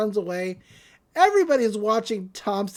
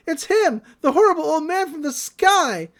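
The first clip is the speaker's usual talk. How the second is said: high-pitched, louder cry of alarm; mock alarm; 7 semitones higher, 5 dB louder than usual. Youth warns the crowd about the old man, mock alarm. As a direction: raise the alarm, hammed-up and high.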